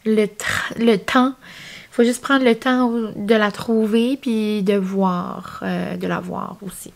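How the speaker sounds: normal talking voice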